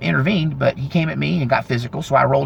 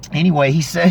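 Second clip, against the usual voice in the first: usual speaking voice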